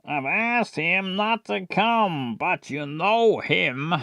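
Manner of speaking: slow, lightly put-on Tom; Tom's character voice. Shown as a demonstration. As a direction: draw the words out a little. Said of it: "Tom should do a really noisy inhale".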